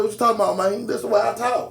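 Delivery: lightly put-on country accent; imitated country twang. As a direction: mimic a country soldier defending his twang. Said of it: "why you talking like that".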